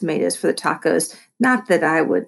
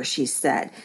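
usual speaking voice

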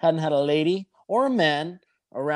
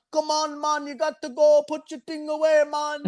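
a mock Jamaican accent, much higher, yelling it; 10 semitones higher than usual. Lifeguard chasing a man off the beach, Jamaican accent yelling. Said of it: "the fucking Jamaican lifeguard's there with a towel".

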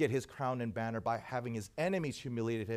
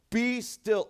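higher, louder royal command; conquering king; 9 semitones higher, 6 dB louder than usual. Retold or imitated. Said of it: "something that a conquering king would say".

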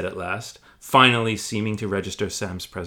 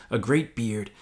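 ordinary talk, nothing marked

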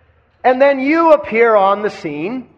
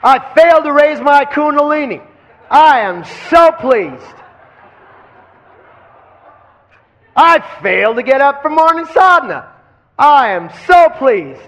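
louder, higher and pleased; delighted; 6 semitones higher, 5 dB louder than usual. Shown as a demonstration. Teacher voicing a student's glee, loud and delighted. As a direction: celebrate the failure out loud. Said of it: "what do you say about".

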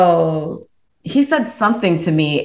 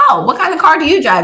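high, quick, bright curiosity; eager question; 9 semitones higher, faster than usual. Retold or imitated. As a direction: ask it fast and high with fake interest. She asks back in turn.

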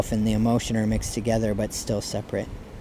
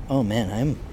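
ordinary talk

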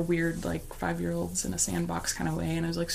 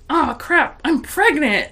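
higher, louder shock; sudden realization; 8 semitones higher, 8 dB louder, slower than usual. Retold or imitated. Realizing at work she is pregnant, startled. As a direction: let the truth land all at once. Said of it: "it hit me all at once".

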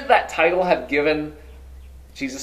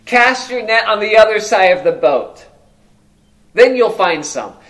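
calling out instruction; 4 semitones higher, 7 dB louder than usual. Acted. Jesus calling instruction to boat.

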